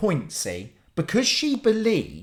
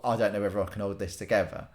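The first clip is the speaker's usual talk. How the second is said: quick, soft, lower inner voice; inner thought; 10 semitones lower, 8 dB quieter, faster than usual. Retold or imitated. Son watching dad's prank, thinking quickly and softly. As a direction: think it fast under the breath. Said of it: "and I thought".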